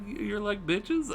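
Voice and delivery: soft, slow, tentative question; mock a timid attempt at an insult; hesitant, hushed name-calling; imitating reserved insult. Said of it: "I can't even do it the way he does".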